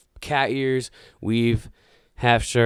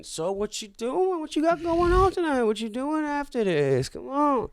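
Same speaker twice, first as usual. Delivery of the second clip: much higher, coaxing put-on woman's voice; flirty passenger voice; 13 semitones higher than usual. Passenger fishing for a hookup, high coaxing voice. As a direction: coax him in a high sing-song voice.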